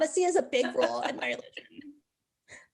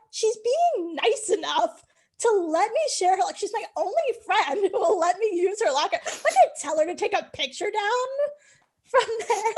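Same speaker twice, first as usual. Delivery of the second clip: retort, higher; 7 semitones higher, 5 dB louder than usual. Retold or imitated. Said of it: "And I'm like".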